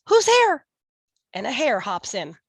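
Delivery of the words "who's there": high, loud squeaky mouse cry; a tiny mouse calling out in alarm. Acted. Mouse startled by eyes at the den, high loud cry. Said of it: "Mouse cries".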